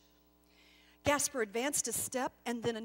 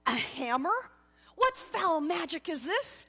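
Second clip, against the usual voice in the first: louder, higher indignant outburst; outraged shock; 5 semitones higher, 7 dB louder than usual. Acted. Gasper accusing the angel of foul magic, outraged. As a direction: demand an answer, appalled.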